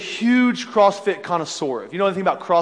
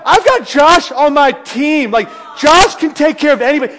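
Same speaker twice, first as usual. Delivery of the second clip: louder, higher, puffed-up swagger; cocky boast; 6 semitones higher, 6 dB louder than usual. Retold or imitated. Swaggering to the rival fans, loud and cocky. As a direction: strut it, suddenly brave. Bolstered by big friend.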